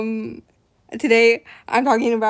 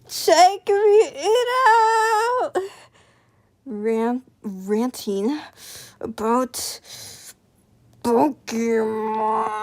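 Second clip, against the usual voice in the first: singsong promo; much slower than usual. Pretend.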